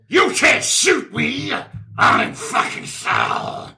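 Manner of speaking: slow, drawn-out monster taunt, breaking into harsh voiceless rasp. Acted. Scary monster defies the shooter, slow rasping taunt. Monster answers player's attack.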